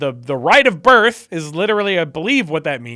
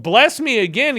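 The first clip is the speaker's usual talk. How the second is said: mock-desperate, higher-pitched plea; freaking out; 6 semitones higher, 4 dB louder than usual. Son imitated begging his father for a blessing, freaking out. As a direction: beg in a retold mild panic. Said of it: "He starts freaking out".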